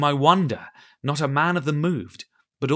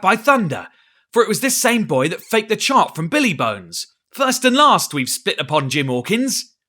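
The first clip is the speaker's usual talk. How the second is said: higher, louder pirate rant; angry; 7 semitones higher, 7 dB louder than usual. A narrator's reading. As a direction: rage at the boy. Silver rails at Jim.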